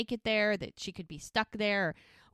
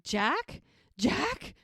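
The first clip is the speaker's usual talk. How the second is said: questioning name, leaping much higher on repeat; retold disbelief; 5 semitones higher than usual. Mel hears Jack and can't believe he's there, retold disbelief. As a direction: call it as a rising, incredulous question. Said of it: "she can't believe that he's there".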